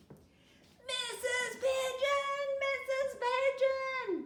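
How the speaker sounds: high, loud, drawn-out calling; desperate to be picked; boy calling out to the teacher for attention; eager calling out. A narrator's reading. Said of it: "waving his hand".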